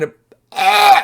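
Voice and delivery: very high, loud "ah"; sudden mock pain cry; pained yelp. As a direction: yelp at the burn inside the nose. Acting out burning nose.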